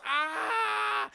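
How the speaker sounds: high yelled scream; a cartoon scream as he falls; falling scream. Officer thrown off the blimp, high yell.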